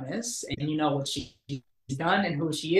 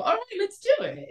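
nervous agreement. Retold or imitated. Nervous reply to Sharon Stone.

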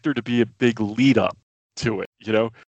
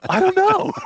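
very high squeaky reply; playful high-pitched retelling; hammed-up uncertainty; 4 dB louder than usual. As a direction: act it out in a comic falsetto squeal. Retold reply, acted in squeal.

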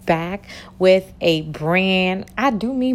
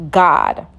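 ordinary talk

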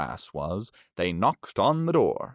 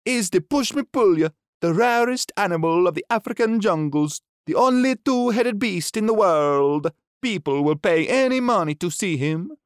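Chi-Chi's higher showman voice; monkey presenting; 10 semitones higher, 6 dB louder than usual. Acted. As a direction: present it grandly, pitched up.

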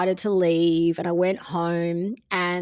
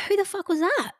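higher, voicing the stunned friend; friend's voice; 9 semitones higher than usual. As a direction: toss the line up high like the friend. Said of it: "My friend's like".

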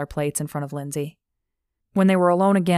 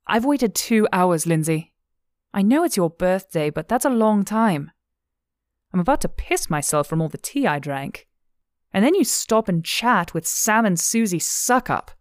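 higher, louder scolding of a friend; exasperated; 3 semitones higher, 3 dB louder than usual. Acted. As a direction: vent your impatience at her. Friend scolds Lindsay for long wait.

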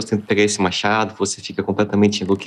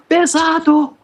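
even higher strained yell from far off; shouting from above; 3 dB louder, much slower than usual. Acted. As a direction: yell it down, straining under the weight. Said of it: "o Ori gritando lá de cima".

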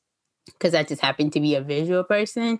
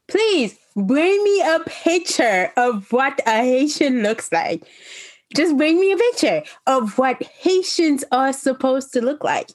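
exasperated challenge; 8 semitones higher than usual. Retold or imitated. Haitian speaker challenging people who doubt her heritage, exasperated. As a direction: demand proof, stressing it phrase by phrase.

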